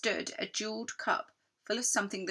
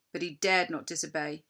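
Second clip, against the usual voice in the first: ordinary delivery, nothing marked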